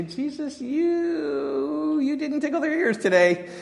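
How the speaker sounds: hammed-up sermon critique; disciples' mock critique. Disciples telling Jesus his sermon failed, high and teasing. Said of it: "And they say".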